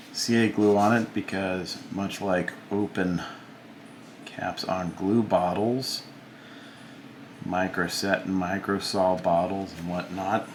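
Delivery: ordinary talk, nothing marked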